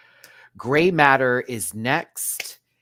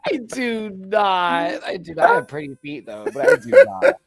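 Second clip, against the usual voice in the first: laughing denial; 10 semitones higher, 7 dB louder than usual. Real, not acted. Teased host protests while laughing. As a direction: deny it through laughter. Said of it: "denying teasing, laughing".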